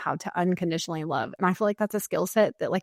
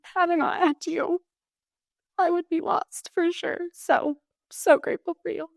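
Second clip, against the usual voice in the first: much higher, tear-choked voice; crying gratitude; 9 semitones higher than usual. Real, not acted. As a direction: push the thanks out through crying. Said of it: "Sorry, I'm gonna cry".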